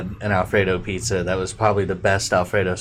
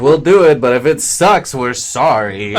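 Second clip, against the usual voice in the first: imitating the pizza guy; 5 semitones higher, 8 dB louder than usual. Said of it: "they're like".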